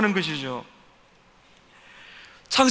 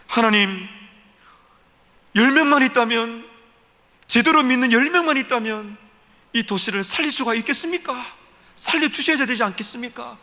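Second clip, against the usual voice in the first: higher, pressing each demand harder; arguing insistently; 6 semitones higher than usual. Acted. Abraham arguing with God to save ten righteous, insistent and higher. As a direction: bargain hard, almost arguing with God. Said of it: "대화하면서 막 따지듯이".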